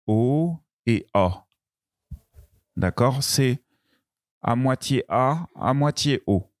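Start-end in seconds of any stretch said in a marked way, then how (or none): 0.1-1.5 O et A drawn out slowly, each vowel clear. Shown as a demonstration. Teacher contrasting vowels, slow and clear. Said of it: "Le plus difficile".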